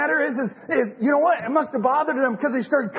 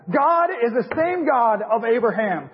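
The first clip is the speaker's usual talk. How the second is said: usual speaking voice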